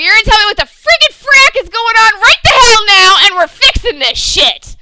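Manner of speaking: high, loud, put-on rant; mock furious demand. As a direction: demand answers like a man at his limit. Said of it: "That's not really what he said".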